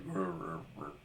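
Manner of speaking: quiet deep troll rumble; troll growl. Retold or imitated. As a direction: grumble low like a troll. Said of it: "an actual troll would be like".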